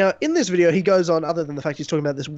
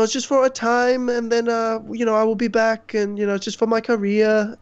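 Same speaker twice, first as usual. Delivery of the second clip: put-on Spanish accent; 4 semitones higher than usual. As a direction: mimic her Spanish accent lightly. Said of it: "in a Spanish accent".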